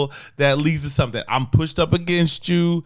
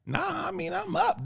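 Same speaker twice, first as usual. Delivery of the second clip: high, soft imitation of his partner; mocking her reply; 7 dB quieter than usual. Boyfriend mimicking his partner's answer, high and soft. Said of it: "You said".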